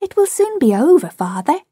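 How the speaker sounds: put-on high, quick boyish cheer; cheery. A narrator's reading. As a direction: chirp it brightly to encourage father. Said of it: "John said cheerily".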